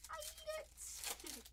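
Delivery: high, slow cartoon sponge pleading; SpongeBob voice. Pleading to the breaker for a hit in a SpongeBob voice. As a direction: beg for the card like SpongeBob. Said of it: "I read that in the SpongeBob voice".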